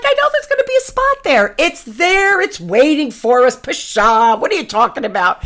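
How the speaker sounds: high, bright certainty that settles down; playfully sure of herself; cocky confidence. Retold or imitated. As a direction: wave off the doubter, sky-high at first. Acting her confident self.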